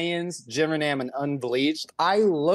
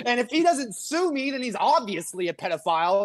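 imitating Mersh, higher; 7 semitones higher than usual. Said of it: "And he's like".

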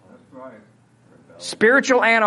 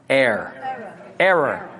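Missouri accent; 5 semitones lower than usual. Shown as a demonstration. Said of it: "saying it in my Missouri accent".

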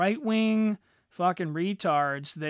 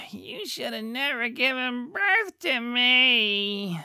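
put-on higher, slow whiny kid voice, dragging out birth; mock whiny spoiled kid; 6 semitones higher, slower than usual. Mocking a spoiled kid who sues his parents, slow and whiny. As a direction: sneer it like a spoiled brat blaming mom.

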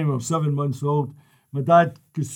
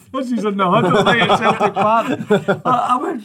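laughing; 3 semitones higher, 6 dB louder than usual. Real, not acted. Laughing while telling huddle story.

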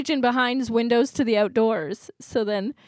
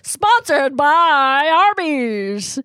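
loud, very slow ad voice; ad announcer; 4 semitones higher, 8 dB louder, much slower than usual. Pretend. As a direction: read it like a sponsor plug. Mock ad tag.

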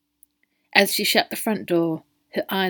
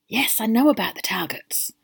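whispered; impatient whisper between colleagues; hushed; 5 semitones higher than usual. Acted. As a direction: whisper it tersely so she can't hear. Men argue in hushed tones.

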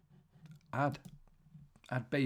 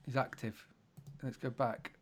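ordinary talk, nothing marked

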